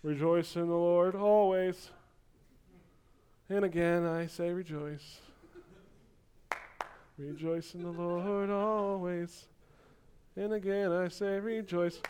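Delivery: soft, slow and dutiful, barely above a murmur; half-hearted rejoicing. Retold or imitated. A congregation mumbling the verse with no joy, softly and slowly. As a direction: sing it like you would rather not be here. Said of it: "and here we come and we're like".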